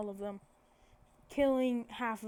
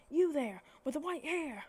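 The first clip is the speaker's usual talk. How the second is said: calling out; 5 semitones higher, 3 dB louder than usual. Retold or imitated. Acted teacher calling out student.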